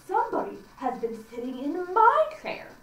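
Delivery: a lightly voiced mother bear; middle bear. A narrator's reading. Narrator voicing the middle bear about her chair. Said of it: "in his middle voice".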